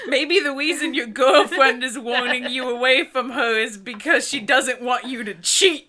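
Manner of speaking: mock the hero through laughter; laughing sarcastic jab. Real, not acted. Sarcastic laughing advice to character.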